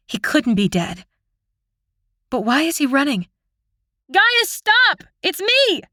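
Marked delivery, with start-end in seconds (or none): 4.1-5.8 crying out. A narrator's reading. Sister calling out to her fleeing brother. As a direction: shout so he stops running. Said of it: "I cry out".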